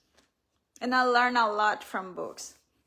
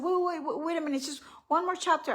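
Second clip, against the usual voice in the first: higher-pitched, quick mock protest; retold pleading stall; 6 semitones higher, faster than usual. Mother stalling her son at her desk, pleading. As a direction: beg for a moment more, pitched up.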